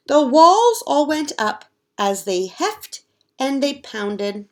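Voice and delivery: normal talking voice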